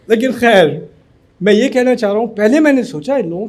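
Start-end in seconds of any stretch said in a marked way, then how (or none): none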